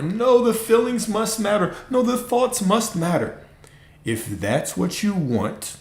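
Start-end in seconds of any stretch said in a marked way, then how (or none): 0.0-3.4 much higher whiny protest; high mock plea that feelings matter; mock protesting follower. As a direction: squeal it like a stubborn student arguing. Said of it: "arguing for it".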